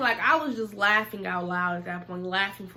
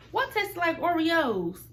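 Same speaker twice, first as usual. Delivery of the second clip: curious question; 8 semitones higher, 3 dB louder, slower than usual. Retold or imitated. Curious question to her friend.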